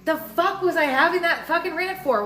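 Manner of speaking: annoyed at her own rant; frustrated self-quote. Retold or imitated. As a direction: scold yourself mid-rant, fed up. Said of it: "And I'm like".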